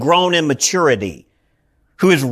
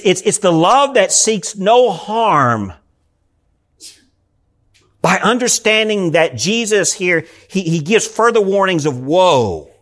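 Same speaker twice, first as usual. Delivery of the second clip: ordinary delivery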